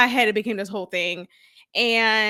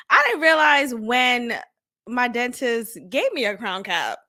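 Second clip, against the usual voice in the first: ordinary delivery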